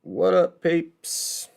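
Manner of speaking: high, loud, chipper hello; upbeat greeting. Real, not acted. Streamer greeting the viewers with a high loud hello. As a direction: call out to the chat.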